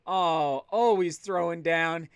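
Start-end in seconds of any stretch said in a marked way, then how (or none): none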